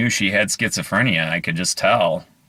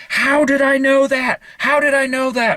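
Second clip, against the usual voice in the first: much higher and louder, near a squeal; frantic disbelief; 4 dB louder than usual. Retold or imitated. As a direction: freak out at your own guess. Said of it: "he was so upset because".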